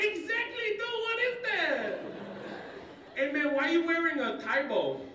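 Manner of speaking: put-on high, loud Mexican-accented kid; Mexican schoolkid. Classmate quizzing the new boy, high Mexican kid voice. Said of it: "this other kid comes up".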